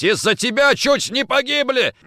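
high, loud accusation; furious yelling. A narrator's reading. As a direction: roar blame while striking. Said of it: "орал Никитин, продолжая бить".

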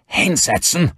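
high, loud sharp command; barked order. Acted. Armed father orders his son to sit, loud barked command. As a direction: bark the order.

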